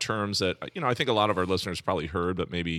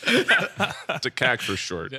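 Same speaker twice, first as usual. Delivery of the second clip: laughing; 5 semitones higher, 3 dB louder, much slower than usual. Real, not acted. Joke cracks up the room.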